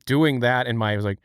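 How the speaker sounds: ordinary delivery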